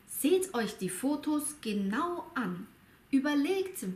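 ordinary delivery

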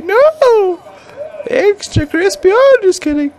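high teasing. Pretend. Teasing burning teammate.

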